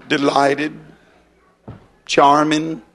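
lightly mocked limp, dainty greeting; silly effeminate greeting. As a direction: mildly imitate a silly, unmanly man shaking hands. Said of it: "A bunch of silly people".

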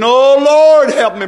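loud, high cry to the Lord, acted out; preacher mimicking a desperate beggar in prayer; begging plea. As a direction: imitate a pleading prayer, swelling on "Lord". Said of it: "I'm begging and I'm pleading".

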